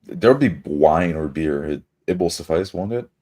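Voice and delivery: normal talking voice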